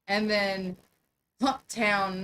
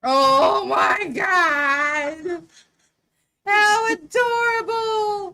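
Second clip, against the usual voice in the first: loud, high, drawn-out squeal of delight; real high-pitched cooing excitement; delighted gushing; 10 semitones higher, 10 dB louder, much slower than usual. Real, not acted. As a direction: gush loudly over puppies, stretching each word. Gushing over a puppy video.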